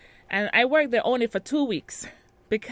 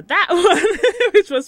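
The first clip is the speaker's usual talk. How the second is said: high, loud, words breaking into laughter; laughing; 11 semitones higher, 10 dB louder, much slower than usual. Real, not acted. Listing past jobs to interviewer, laughing. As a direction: crack up while carrying on talking.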